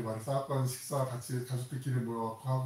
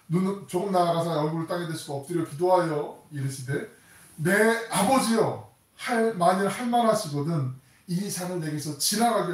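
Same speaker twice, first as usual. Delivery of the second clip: loud preaching read, slowing on 내 아버지여; forceful scripture reading; 4 semitones higher, 6 dB louder than usual. Acted. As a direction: proclaim the verse with full force. Reads Gethsemane prayer forcefully.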